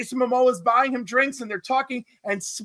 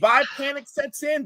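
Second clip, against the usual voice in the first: usual speaking voice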